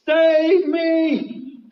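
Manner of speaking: loud, high-pitched plea for rescue; crying out. Acted. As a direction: shout desperately for help. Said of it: "So he cries out to Jesus".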